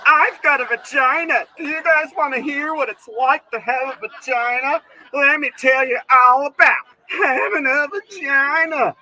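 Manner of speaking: high, loud whiny woman voice; mock female comic. Comic imitating a female comedian, high and whiny. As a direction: whine it like a mocked comedian. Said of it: "they'll go up on stage and be like".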